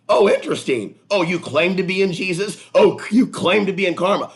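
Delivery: high, loud mocking tone. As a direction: taunt the viewer. Mocking imagined viewer.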